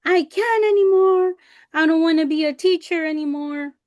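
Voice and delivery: imitating an overwhelmed, high-pitched cry. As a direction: whine it like a dramatic meltdown. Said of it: "I cried like Riley".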